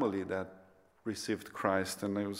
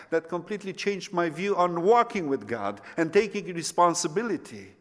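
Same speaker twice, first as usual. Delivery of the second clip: raised, louder, emphatic testimony; impassioned preaching; 7 semitones higher, 5 dB louder than usual. Real, not acted. Preacher telling how his view completely changed, impassioned preaching. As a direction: preach it higher and louder, with conviction.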